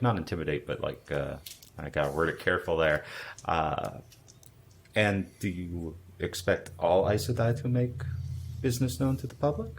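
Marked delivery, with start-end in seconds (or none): 4.9-9.7 haughty. Acted. Player bluffs as Aes Sedai.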